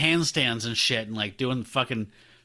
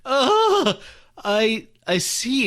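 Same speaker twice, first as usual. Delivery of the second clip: high, loud mock-horrified "oh", then halting; 9 semitones higher, 4 dB louder, much slower than usual. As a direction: act out stunned horror, high-pitched and hesitant. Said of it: "he looks horrified".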